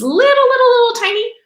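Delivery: little, little tiny squeaked high and loud; squeaky tiny. Acted. Teacher squeaks over the tiny spinach leaf, high and loud.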